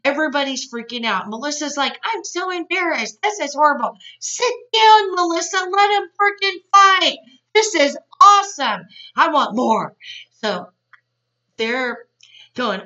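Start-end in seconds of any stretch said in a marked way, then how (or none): 2.0-4.0 high-pitched mock dismay; Melissa, mortified. 4.2-9.9 loud, high-pitched heckle at the TV; gleeful yelling. Pretend. Gleefully shouting back at the show.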